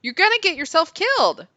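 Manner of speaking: loud high shout. Acted. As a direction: yell at the man. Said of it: "he shouted".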